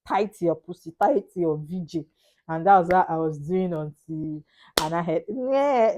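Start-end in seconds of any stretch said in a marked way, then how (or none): 5.3-5.9 high, mock newborn wail; imitated baby cry.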